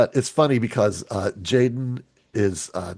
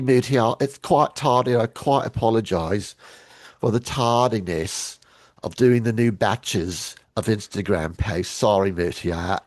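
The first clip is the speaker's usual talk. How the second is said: put-on English accent. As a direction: put on a hammed-up British accent to apologise. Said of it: "I have to say it in an English accent".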